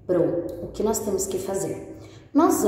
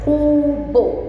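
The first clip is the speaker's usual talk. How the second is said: "cubo" stretched slowly into two syllables, higher; 7 semitones higher, 6 dB louder than usual. Shown as a demonstration. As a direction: sound out "cubo" as cu, then bo. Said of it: "a nossa sílaba cu com a nossa sílaba bo".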